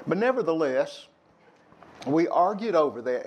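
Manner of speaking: usual speaking voice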